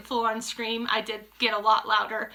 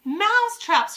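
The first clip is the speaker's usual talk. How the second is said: high, loud echo from the coven; crying out; 10 semitones higher, 6 dB louder, slower than usual. A narrator's reading. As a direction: witches exclaiming together. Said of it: "cried the witches".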